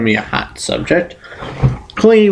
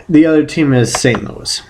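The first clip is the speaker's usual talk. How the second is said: usual speaking voice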